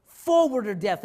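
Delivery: slow, loud, rousing sermon cry; preaching at full force. Acted. Preacher urging the congregation, slow and loud. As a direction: drive the call home to the church.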